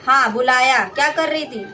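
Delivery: lightly voiced stern mother-in-law; scolding mother-in-law. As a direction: snap back at the bride.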